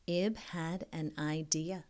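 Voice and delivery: ordinary talk, nothing marked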